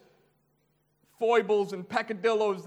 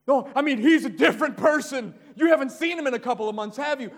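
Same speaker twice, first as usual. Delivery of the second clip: excited neighbor; 6 semitones higher, 6 dB louder, faster than usual. Retold or imitated. Neighbor excitedly reports John's conversion.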